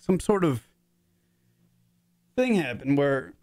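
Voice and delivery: voice breaking with emotion. Real, not acted. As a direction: emotion catching in the throat as he tries to speak. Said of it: "Why am I an emotional fucking prick?".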